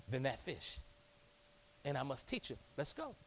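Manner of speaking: soft, coaxing invitation to follow; gentle call. Acted. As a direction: softly urge them to drop the nets. Jesus calling the fishermen.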